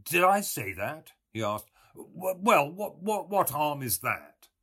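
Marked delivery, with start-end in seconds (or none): 0.0-1.1 bright, louder mocking young man; Buck Mulligan voice. A narrator's reading. 1.9-4.5 higher, stammering, caught out; flustered Mulligan. A narrator's reading. Mulligan stammering excuses to Stephen, higher.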